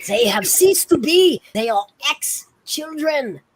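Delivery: put-on high, emphatic accented insistence; theatrical, high-pitched protest; heated insistence. Acted argument about ghost children.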